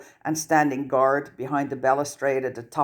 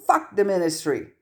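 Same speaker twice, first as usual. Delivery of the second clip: yelled; 8 semitones higher than usual. A narrator's reading. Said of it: "Ginny yelled".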